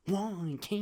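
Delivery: put-on high puzzled kid; kid whining. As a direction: ask it like a confused toddler. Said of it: "And I'm like".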